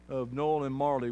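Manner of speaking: normal talking voice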